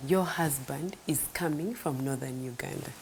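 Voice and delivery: soft unvoiced whisper, low and hushed; a hushed revelation in her ear; whispered divine message. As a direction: whisper it as the Spirit's quiet word. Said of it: "the Holy Spirit told me".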